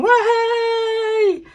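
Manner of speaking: high, gleeful cartoon "Wahey"; happy turtle cheer. Voicing a happy turtle on the shelf, high gleeful whoop. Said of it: "He's saying".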